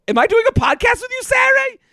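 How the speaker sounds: high, fast shouted disbelief; alarmed shout. Retold or imitated. Comedian retelling shouting at the van guy in disbelief. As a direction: yelp it out in panic.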